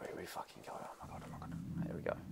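scared whisper under the breath. Real, not acted. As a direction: breathe it out in fear. Bracing for horror game.

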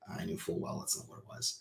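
almost whispered aside; hushed. Real, not acted. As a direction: drop to a near whisper. Confiding he hid the truth.

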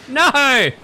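high, full-volume yell; loud protest. Real, not acted. Player caught by the crew, high loud shout. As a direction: cry out as the ruse collapses.

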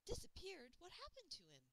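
high, loud sudden question; blurted surprise. Acted. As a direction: blurt it out in shock. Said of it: "flew out of my mouth".

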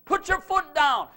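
loud, high commanding preacher; fiery sermon climax; full-force preaching. Acted. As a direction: drive every line home to the crowd.

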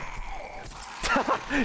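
short high laugh; amused laugh. Real, not acted. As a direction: burst out laughing softly. Amused by enemy hitting teammate.

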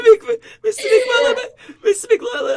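laughing hard. Real, not acted. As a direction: gasp it out mid laughing fit. Laughing fit.

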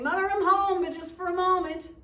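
high, loud call through the house. Acted. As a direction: shout it to mother on the run.

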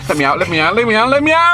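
escalating frantic demand to get out; building panic. Real, not acted. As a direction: start near normal, end in a high, loud cry.

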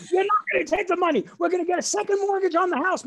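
high, fast, loud mock screech; mother arguing back. Mother refusing the scholarship money, screaming. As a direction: imitate a frantic mother overruling her husband.

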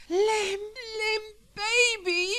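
very high, loud, drawn-out wail. Acted. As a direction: wail his name across the street. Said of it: "wailing at the top of her lungs".

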